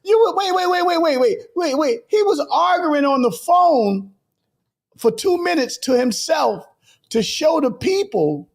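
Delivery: high, slowed disbelief; can't believe what he's telling; incredulous. Real, not acted. Recounting the coworker's phone stunt, incredulous.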